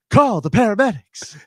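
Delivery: high, loud hammed-up machine voice; mock announcer callout. Host mimicking the Rescue 911 pinball machine, hammed-up callout. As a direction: shout it out like a cheesy pinball cabinet.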